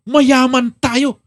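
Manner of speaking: slow, loud and stressed; drawn out for emphasis; emphatic. Acted. King reassures his son. (mabagal at madiin)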